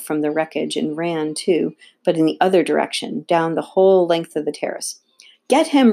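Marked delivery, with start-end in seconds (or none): 5.5-5.9 high, loud cry to give chase; raised, urgent shout; screamed order. A narrator's reading. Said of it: "Rollo screamed".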